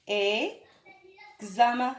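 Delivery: "examen" drawn out syllable by syllable; very slow spelling-out. Shown as a demonstration. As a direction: stretch "examen" slowly for pupils. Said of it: "J'écris un exemple avec vous". (نطق بطيء جدًا لكلمة examen)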